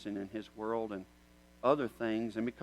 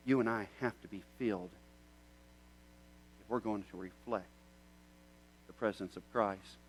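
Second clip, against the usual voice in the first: normal talking voice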